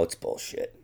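unvoiced low mutter; hushed, voiceless grumble; whispered. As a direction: whisper it in annoyance. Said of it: "he says something like".

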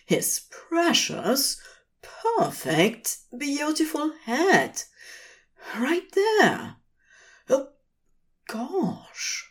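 high, choked-up delight; breathless wonder, voice catching; overjoyed, near tears. A narrator's reading. Aziraphale overwhelmed with joy.

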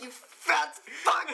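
high, loud, slow yell through laughter; laughing mock yell. Friend echoes the outburst, loud slow laughing mock yell. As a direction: bellow it while laughing. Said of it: "He's like".

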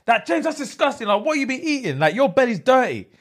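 high-pitched, disgusted telling-off; imitating an irate classmate; angry. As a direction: scold him in shrill outrage. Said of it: "she's actually angry".

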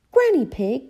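high, loud greeting calls; Peppa's excited cry. A narrator's reading. Peppa arriving at her grandparents', excited cry. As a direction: call out to beloved grandparents. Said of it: "cried Peppa".

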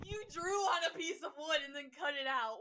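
loud laughing disbelief; cracking up in disbelief. Real, not acted. Sibling teasing, cracking up.

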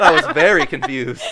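talking through loud laughter; laughing. Real, not acted. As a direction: laugh out the words. Says it laughing.

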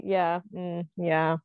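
normal talking voice

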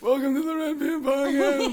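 put-on high, quavering old man intro. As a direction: croak it out like he's ninety. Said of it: "when we record this podcast when he's, like, ninety".

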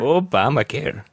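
put-on mild Canadian accent on Obamacare, falling; lightly voiced Canadian accent; mock Canadian accent. Co-host repeating the practice word, a mild Canadian accent. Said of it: "let's master our Canadian accent".

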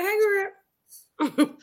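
put-on very high, playful hey-girl; sweet greeting. Listener showing how she takes a nice call, sweet. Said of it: "I be like".

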